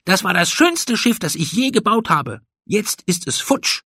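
high-pitched, furious accusation; angry yelling. A narrator's reading. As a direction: lash out at your friend in a high voice. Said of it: "schrie er Eddy an".

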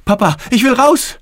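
loud, high cry to get out; high-pitched, urgent shout; panicked child yell. A narrator's reading. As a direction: yell like a frightened little boy. Child cries to get out of the pool.

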